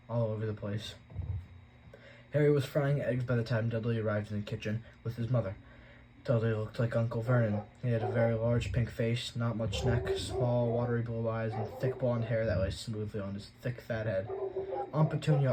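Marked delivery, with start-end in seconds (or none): none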